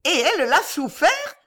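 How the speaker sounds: high-pitched scandalised question; exclaiming. A narrator's reading. Old lady appalled at her daughter, exclaiming. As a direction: cry out in disbelief. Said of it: "s'écria la vieille dame".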